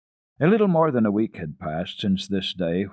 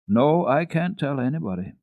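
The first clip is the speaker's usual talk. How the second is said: decidedly; 6 semitones higher, 4 dB louder than usual. A narrator's reading. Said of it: "said De-, Heidi decidedly".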